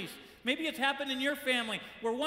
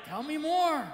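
higher and louder, hungry for gossip; eager for gossip; 5 semitones higher, 5 dB louder, slower than usual. Retold or imitated. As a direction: lean in, eager to hear more dirt. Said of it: "we're like".

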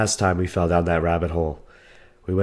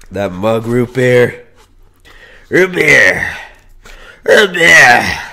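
loud, growing louder, drawn out; excited root beer; 2 semitones higher, 10 dB louder, much slower than usual. Real, not acted. Eager to slurp his mug of root beer, shouting it louder each time. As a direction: hype up the drink.

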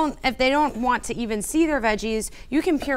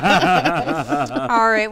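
laughing; 6 dB louder than usual. Real, not acted. Laughing after a to-go joke.

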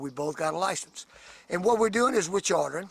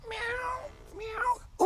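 playful high-pitched meow; cat meow; 5 dB quieter than usual. Pretend. Fishing guide teasing he'll let the cat out, playful meow. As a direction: mimic a cat with a high mew. Imitates a cat before joke.

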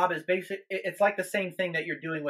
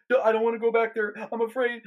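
higher, rushed, acted-out fear; imitated freak-out; 6 semitones higher, 3 dB louder, faster than usual. Minister imitating the frightened part of a woman, freaking out. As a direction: mimic someone panicking and refusing to go. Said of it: "she starts freaking out".

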